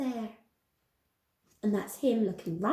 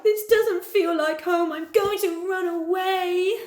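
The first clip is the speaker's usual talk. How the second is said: high, plaintive storybook wail; crying out; 6 semitones higher, 4 dB louder than usual. A narrator's reading. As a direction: wail it, stretching the end. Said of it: "And he cried".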